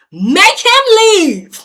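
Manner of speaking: loud, high, drawn-out yell; exasperated shout at an officer; angry demand. Retold or imitated. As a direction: yell the demand, stretching every word.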